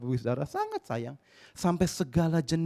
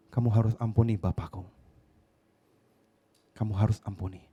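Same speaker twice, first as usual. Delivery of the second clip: gentle urging; 8 semitones lower than usual. Retold or imitated. Pastor urging forgiveness.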